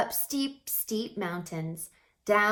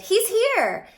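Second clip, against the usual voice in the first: much higher, loud excited cry; joyful shout; 13 semitones higher, 8 dB louder than usual. A narrator's reading. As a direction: shout the good news. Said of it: "they shouted".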